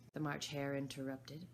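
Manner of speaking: plain reading voice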